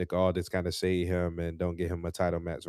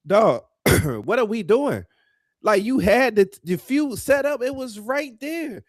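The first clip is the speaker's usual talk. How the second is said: exasperated outburst; 12 semitones higher, 5 dB louder than usual. Real, not acted. Wrestling podcaster questioning a botched feud, exasperated. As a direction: rant in a high, incredulous voice.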